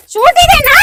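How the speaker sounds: loud, high, furious accusation; angry shout. Acted. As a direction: yell it at the lying husband. Wife confronts husband at shoot. (భర్తను నిలదీస్తూ కోపంగా అరవడం)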